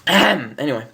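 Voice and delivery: usual speaking voice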